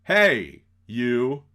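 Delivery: loud, confrontational call-out; loud, contemptuous threat; belligerent challenge. Acted. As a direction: shout him down like a bully. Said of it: "And says".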